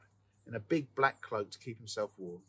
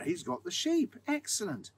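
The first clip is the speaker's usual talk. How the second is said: pleased miller; 12 semitones higher than usual. A narrator's reading.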